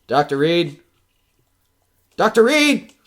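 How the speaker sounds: from a worried call up to a high, loud desperate shout; rising panic. Acted. Man alone in the dark calls for the doctor, building to a panicked shout.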